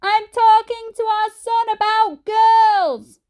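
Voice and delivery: high, loud, slow shout. A narrator's reading. Mum shouting down to Dad, slow and loud. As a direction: spell it out at full volume. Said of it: "shouted Mum".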